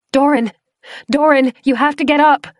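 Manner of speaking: high, loud urgent pleading; girl yelling at a half-frozen friend; frantic shout. Acted. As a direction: shout to wake him. Shaking him awake.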